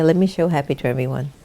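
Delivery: normal talking voice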